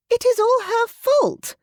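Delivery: high, loud, desperate accusation; crying out wildly. Acted. Louisa blaming Ellen from the floor, wild cry. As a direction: fling the blame across the room. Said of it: "Louisa cried".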